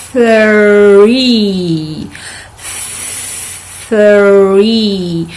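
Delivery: "Three" very slowly, with a long soft TH. Shown as a demonstration. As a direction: stretch the th in "three". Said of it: "put it slower for you".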